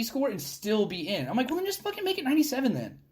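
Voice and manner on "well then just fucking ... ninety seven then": high, fast sarcastic; fed up with the rules; exasperated. Retold or imitated. Exasperated retort.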